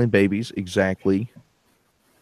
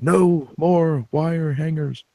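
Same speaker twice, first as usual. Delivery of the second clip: put-on high, drawn-out baby wail of protest; mock baby; 6 semitones higher, much slower than usual. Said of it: "the baby's like".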